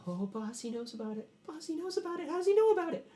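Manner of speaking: high, rising panic; panicked. Acted. Companion panics to his boss that the lord knows about it. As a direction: squeak out the alarm, voice climbing.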